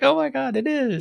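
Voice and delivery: high-pitched mock gasp of recognition; light, high-voiced amazement; retold amazement. Telling co-host how they spotted the reused set, amazed.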